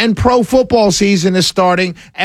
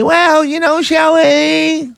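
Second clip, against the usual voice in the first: high, slow mocking tone; 9 semitones higher, slower than usual. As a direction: mimic them scornfully. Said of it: "Don't tell me".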